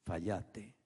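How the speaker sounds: hushed, low and soft; soft voice of God. Acted. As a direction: say it gently like a quiet inner voice. Espíritu Santo te dice. (voz suave y baja, casi en susurro)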